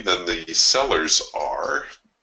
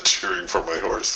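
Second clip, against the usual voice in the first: ordinary talk, nothing marked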